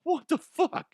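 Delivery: high-pitched what-the-fuck; shocked high outburst; outraged disbelief. Real, not acted. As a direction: squeal it in shock. Outrage at fleeing workers.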